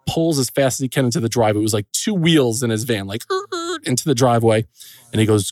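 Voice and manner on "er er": high squealing tire noise, quiet; imitated tire screech. Father's van skidding into the driveway, imitated screech. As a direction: mimic tires skidding round a corner.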